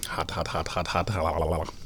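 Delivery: quiet, low hot-tea gasps; sipping scalding tea; mouth full of hot tea. Real, not acted. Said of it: "it's still too hot to drink".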